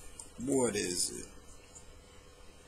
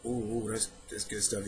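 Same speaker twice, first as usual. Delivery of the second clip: low, hushed swoon; smitten; 4 semitones lower, 14 dB quieter than usual. Retold or imitated. Fan admitting a weakness for accents, quietly smitten. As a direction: savor it quietly under your breath.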